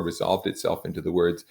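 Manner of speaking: ordinary delivery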